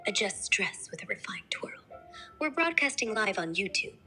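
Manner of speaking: put-on refined British accent; genteel upper-class English accent; posh Queen's English. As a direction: speak the Queen's English, very proper. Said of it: "Give me the posh style Queen's English now".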